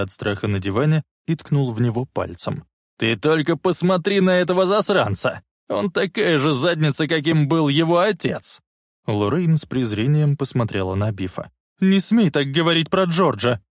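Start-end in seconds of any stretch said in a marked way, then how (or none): 3.0-8.6 raised, higher contemptuous jeer; jeering contempt. Acted. Biff insulting Marty and his father, jeering contempt. Bully jeers at frightened Marty. 11.8-13.6 higher, indignant rebuke; angry exclamation. A narrator's reading. Lorraine defending George to Biff, angry exclamation. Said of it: "гневно воскликнула она".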